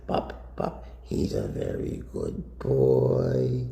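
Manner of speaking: put-on drawn-out, crooning baby talk to a snake; playful singsong with stretched words; cutesy pet talk. Shown as a demonstration. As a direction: coo silly nonsense at a beloved pet. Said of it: "Here's what you do when you have a snake like this".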